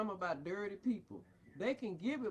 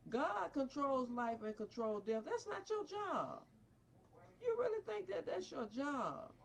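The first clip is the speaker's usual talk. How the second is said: normal talking voice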